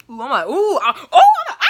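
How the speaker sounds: high, loud fan shrieks; excited squealing. Retold or imitated. Watching All American at home alone, excited shrieks. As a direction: squeal and whoop, laughing. Reacting to favorite show.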